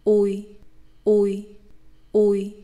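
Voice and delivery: "Ui" gliding from u to i; Vietnamese diphthong ui. Shown as a demonstration. Teacher drilling ui for learners, careful and clear. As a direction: repeat "ui" clearly three times. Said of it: "move your mouth to the position of the vowel e".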